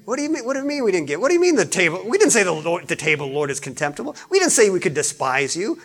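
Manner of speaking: preacher mocking the complaining people; mock indignant people. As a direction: rattle off their defensive excuses. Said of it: "Notice their remarks".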